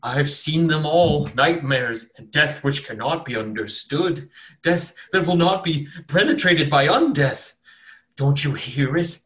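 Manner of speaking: slow, feverish doom prophecy; haunted by visions of death; raving prophet. Acted. Mad cultist prophesying dead dwarves to the cleric.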